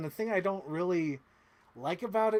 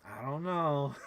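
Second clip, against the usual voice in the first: doubtful sister imitation; slower than usual. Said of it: "Rachel would be like".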